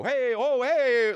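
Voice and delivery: high sing-out hey; mock fishmonger call. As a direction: holler like the fish throwers. Fish throwers' call.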